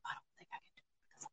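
hushed whisper. Real, not acted. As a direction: whisper it to yourself. Whispered aside.

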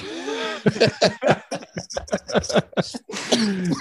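real laughter after the punchline; long laugh. Real, not acted. Laughing at own story.

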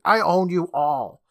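loud, slow mock-tyrant declaration; hammed-up villain claiming power; domineering villain. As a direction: stretch it out imperiously. Villain claims power over captives.

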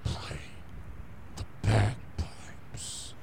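devil whisper. Acted. Satan whispering again.

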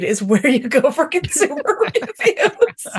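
breaking into laughter mid-sentence; laughing. Real, not acted. Podcaster mocking BuzzFeed as a review source, laughing. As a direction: let the laugh take over toward the end. Cracking up at own sarcasm.

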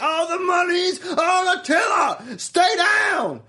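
high, loud, slow taunting yell; mock villain shout. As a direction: bellow it like a smug fight boss. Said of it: "And he's like".

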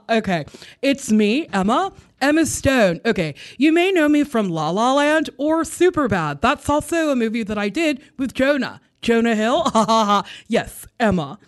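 a hint of a giggly starlet introducing herself; Emma Stone impression. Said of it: "But here's Emma Stone".

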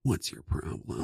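whispered ASMR voice; breathy ASMR hush. Pretend. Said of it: "but we talk like this".

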